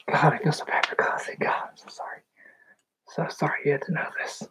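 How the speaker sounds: soft, fast whispered apology; real whisper after crying; tearful whisper. Real, not acted. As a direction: whisper it, still shaken from crying. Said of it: "Had to calm my heart down".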